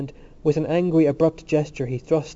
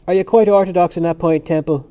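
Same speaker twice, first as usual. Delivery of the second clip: raised, louder suave questioning; 4 semitones higher, 9 dB louder than usual. A narrator's reading. Dixon needles Temple about his orthodoxy, suavely. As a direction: put it suavely, pitched up at the start. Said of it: "Dixon said suavely".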